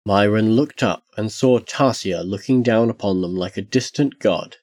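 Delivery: ordinary delivery, nothing marked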